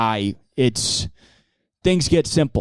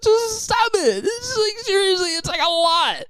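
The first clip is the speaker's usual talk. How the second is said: hammed-up weeping exasperation; mock tearful pleading. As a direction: whine like you're about to cry. Countries begging Israel to stop.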